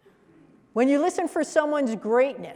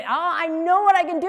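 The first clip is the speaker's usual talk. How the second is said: high, louder burst of realisation; excited, passionate; 7 semitones higher, 5 dB louder than usual. Acted. Coachee discovering what they can do, acted excitement. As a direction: burst out with sudden eager energy. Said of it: "when they get excited about something and they get really passionate".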